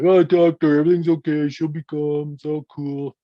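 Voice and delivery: lightly voiced smooth-talking young man; soothing Jason. Jason placating, voiced by father.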